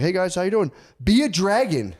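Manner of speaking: put-on high, loud greeting into kid demand; dad and kids. Dad calls his kids on the road, high loud demanding voices. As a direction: pitch it up into a child's shout.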